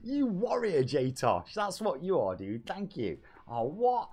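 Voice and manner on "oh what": ordinary talk, nothing marked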